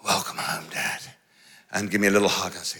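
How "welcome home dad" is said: low, soft mock-sweet greeting; sheepish kids. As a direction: play the sheepish sons.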